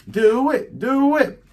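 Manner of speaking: high, loud goading cry; urgent egging-on. Acted. Pirates goad Nami to light the cannon, high urgent egging-on. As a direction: chant it high and loud, pushing for action.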